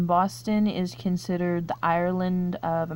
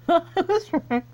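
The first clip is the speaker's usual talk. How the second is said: high, laughing "I was right"; laughing delight; 11 semitones higher, 4 dB louder than usual. Real, not acted. Quiz player happy at a correct guess, laughing. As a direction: giggle through the win.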